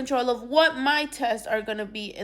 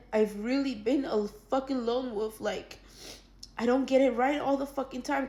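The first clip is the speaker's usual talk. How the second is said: ordinary talk, nothing marked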